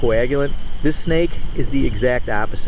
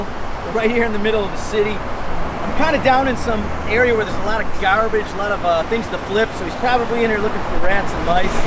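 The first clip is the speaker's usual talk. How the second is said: high, thrilled, can't believe it; bursting with excitement; excited; 7 semitones higher than usual. Real, not acted. Thrilled showing ten-foot python.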